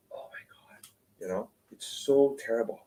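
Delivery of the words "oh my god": whispered mock despair; mock complaining. People facing life's trials, mock-despairing whisper. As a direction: drop to an exasperated whisper, imitating a complainer. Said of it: "we complain and we struggle".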